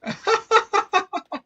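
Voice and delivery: shrill burst of laughter; high squealing laugh. Real, not acted. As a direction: squeal with glee. Laughing at glitch.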